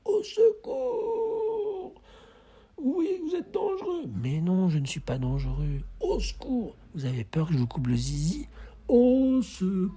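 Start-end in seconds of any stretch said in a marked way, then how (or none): 0.0-4.0 very high, slow tearful wail; mock crying wail. Wailing, crying mock fear. 6.0-6.8 mock cry for help. 8.9-9.9 mock cry for help. Slow high call.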